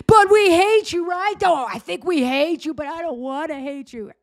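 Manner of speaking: high, loud mock protester voice; whiny, torn imitation of a protester; mock conflicted protester. Imitating the protester who turns on him, high and whiny. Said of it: "And he's like".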